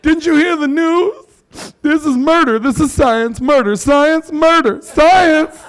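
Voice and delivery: high, slow, drawn-out accusing wail; milk every word like a hysterical zealot; hysterical scold. Retold or imitated. Comic mocking a mask zealot chanting science murder, high and slow.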